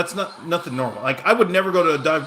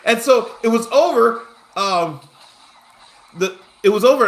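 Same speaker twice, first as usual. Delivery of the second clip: loud, laughing through the words; 7 semitones higher, 7 dB louder than usual. Real, not acted. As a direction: still cracking up. Laughing at the anticlimax.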